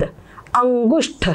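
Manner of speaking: अंगुष्ठ said slowly, each sound drawn out; slow demo of अंगुष्ठ. Shown as a demonstration. Teacher slowly sounding out अंगुष्ठ for students. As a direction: spell out अंगुष्ठ slowly for the class. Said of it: "इस अक्षर को हलंत".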